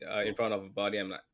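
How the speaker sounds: normal talking voice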